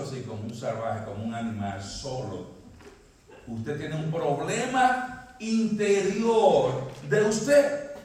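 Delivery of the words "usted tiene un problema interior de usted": slow build to a loud high shout on usted; slow, swelling sermon shout; rising preacher cry. Acted. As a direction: preach it slower and slower, then cry out. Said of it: "preacher building to a cry".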